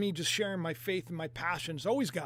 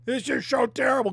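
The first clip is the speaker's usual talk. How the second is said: mock whining; 4 dB louder than usual. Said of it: "everybody's crying".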